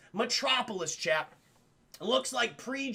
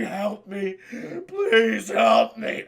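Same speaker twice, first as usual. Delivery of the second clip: mock desperate plea; 8 dB louder, much slower than usual. Daisy begs from inside wall.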